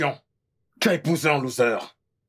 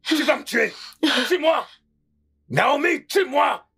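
defiant yell; 7 semitones higher, slower than usual. Acted. Husband daring his angry wife to kill him, shouting.

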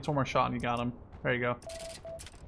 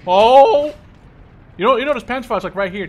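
loud, much higher shout that settles as he points it out; excited shout; 9 semitones higher, 15 dB louder than usual. Real, not acted. Gamer shouting to his teammate about a tank nearby, excited yell. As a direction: yell it in alarm, then calm a little while pointing. Spotting tank and Panzerfaust in game.

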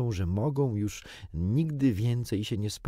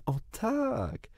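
high, forced "O tak"; Wendy answering Peter in an artificial tone. A narrator's reading. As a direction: put on a fake bright agreement. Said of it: "dość sztucznym tonem".

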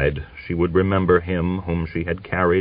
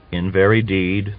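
ordinary delivery

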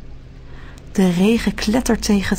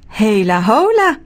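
louder cheerful hela hola; little man's greeting; 4 dB louder than usual. A narrator's reading. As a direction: greet brightly as the little man. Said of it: "zegt het mannetje".

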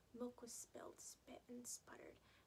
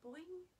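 higher, bouncy boing sound; springy boing; 7 semitones higher, 5 dB louder than usual. A narrator's reading. Hair springing back after Mom pushes it, bouncy boing.

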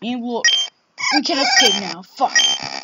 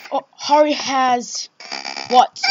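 normal talking voice

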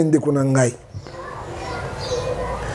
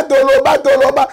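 rising rally cry. Real, not acted. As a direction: chant it like a crowd slogan. Rallying call to speak out.

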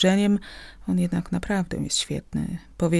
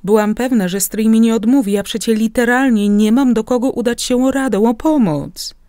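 louder, overjoyed exclamation; delighted cry; 4 semitones higher, 7 dB louder than usual. A narrator's reading. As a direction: burst out happily to the uncle. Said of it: "zawołałam uszczęśliwiona".

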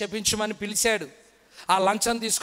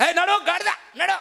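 mock angry urging; 9 semitones higher than usual. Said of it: "అని దాన్ని పొడుస్తున్నాడండి".

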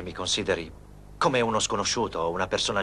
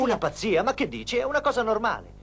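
incredulous protest; 14 semitones higher than usual. Acted. Professor protests Rosa calls love madness.

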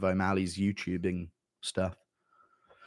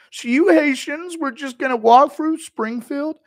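high, loud, slow whine; Schmitty impression; 5 dB louder, slower than usual. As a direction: drag out the offended squeal. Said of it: "Impression of Schmitty left out".

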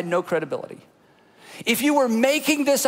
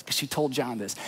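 ordinary talk, nothing marked

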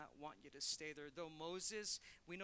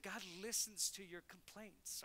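normal talking voice

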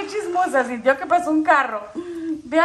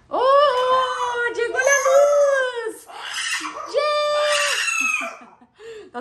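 excited cheer; 10 semitones higher, much slower than usual. Real, not acted. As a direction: cheer as the lights come back. Power returns, cheering. (grito de alegría)

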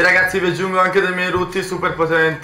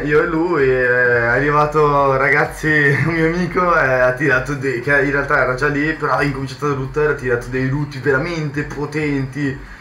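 usual speaking voice

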